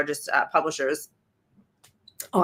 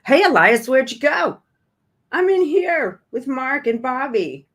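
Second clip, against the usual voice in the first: higher, mock-innocent kids' voices; acting out book dialogue; 4 semitones higher than usual. Reading a book excerpt to her stream, in higher kids' voices. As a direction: read the boys' lines like a sneering children's skit. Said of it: "this is another beaut".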